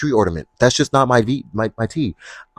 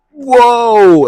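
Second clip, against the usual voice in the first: "Whoa!" imitated much higher and louder, startled; mock shocked whoa; 14 semitones higher, 10 dB louder than usual. Men seeing thigh, mock startled yell. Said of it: "they see too much thigh and they're like".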